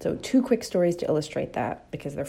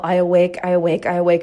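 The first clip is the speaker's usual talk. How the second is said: mock yelling; 7 dB louder, faster than usual. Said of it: "They just yell".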